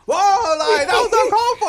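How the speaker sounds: loud, high-pitched imitation of shocked viewers; mock outraged shout. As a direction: shout it like a scandalized crowd, half laughing. Retelling everybody's shocked reaction.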